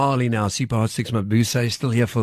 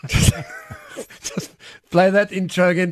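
usual speaking voice

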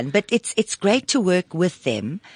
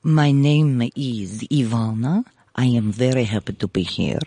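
low, slow Russian-accented voice; deliberate, deep Russian-accented woman introducing herself; 7 semitones lower than usual. Shown as a demonstration. Guest performs for hosts on request, Russian accent. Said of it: "Do the Russian for us".

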